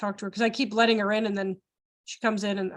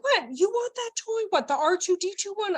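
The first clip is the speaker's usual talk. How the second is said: playful interest; 8 semitones higher than usual. Acted. Voicing adult listening to child's wish.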